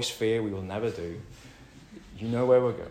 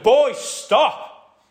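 put-on much higher, louder sharp yell of "Boys, stop!"; retold scream; 14 dB louder than usual. Father stopping his boys wobbling the screens, shouting. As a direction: snap them to a halt with a shout. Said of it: "I screamed out to them".

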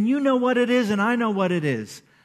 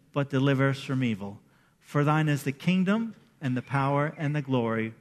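lower, measured recital of a familiar prayer; prayer recitation; 5 semitones lower than usual. Acted. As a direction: lead the room through the prayer together. Leads the Lord's Prayer aloud.

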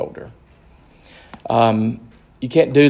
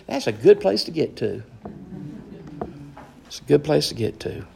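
ordinary talk, nothing marked

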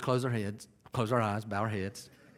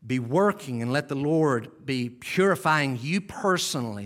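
ordinary talk, nothing marked

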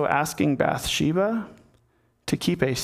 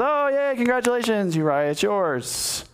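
mock congratulations; 7 semitones higher than usual. David passing the baby off to Uriah, falsely delighted. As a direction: put on a high celebratory yay that drops back at the end.

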